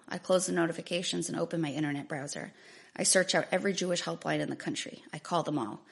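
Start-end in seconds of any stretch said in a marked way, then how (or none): none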